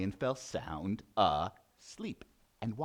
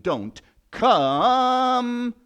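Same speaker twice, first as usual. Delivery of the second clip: loud, high, long-drawn "come"; warning lion; 12 semitones higher, 12 dB louder than usual. Acted. Lion frees the mouse with a warning, bellowing. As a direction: stretch the last word into a bellow.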